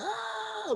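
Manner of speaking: very high, thin squeal at normal volume; high-pitched excited "ah", played for laughs; mock fangirl squeal. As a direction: imitate a starstruck fan squealing. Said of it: "we just started fangirling".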